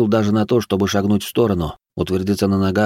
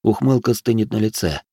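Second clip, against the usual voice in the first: usual speaking voice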